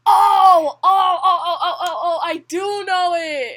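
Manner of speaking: loud, high oh of recognition; sudden realization. Real, not acted. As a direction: realize it all at once, loudly.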